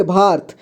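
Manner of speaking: "भारत" as one syllable, bhaart; clipped-vowel mispronunciation. Shown as a demonstration. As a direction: say "भारत" with र joined directly to त. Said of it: "पूरी तरह से उच्चारण न करें तो".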